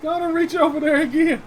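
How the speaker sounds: high, mocking mother's dare; taunting mom. Mom daring her son to reach again, high mocking voice. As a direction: dare him to try it again.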